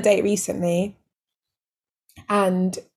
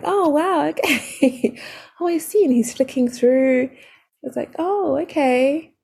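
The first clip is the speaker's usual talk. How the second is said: high, slow drawn-out oh okay with a laugh; mock impressed date; 6 semitones higher, slower than usual. Date flicking through her pole photos, hammed-up high impressed okays. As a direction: play the date, pitched way up and stretched.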